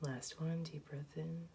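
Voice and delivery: soft, hushed meditation voice; guided meditation. Real, not acted. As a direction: guide them gently into calm, very softly. Leads a guided breathing meditation.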